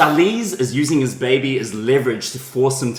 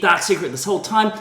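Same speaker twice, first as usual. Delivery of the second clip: normal talking voice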